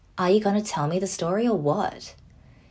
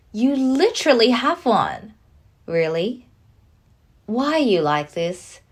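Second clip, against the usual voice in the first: louder and higher exasperation; exasperated; 3 semitones higher, 5 dB louder than usual. Acted. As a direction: call out his lie. Exasperated retort.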